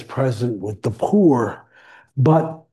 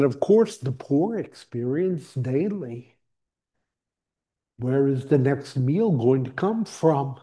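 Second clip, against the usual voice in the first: normal talking voice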